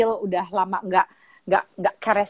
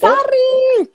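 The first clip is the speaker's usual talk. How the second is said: calling out; 9 dB louder than usual. Real, not acted. Calling out to Sari playfully.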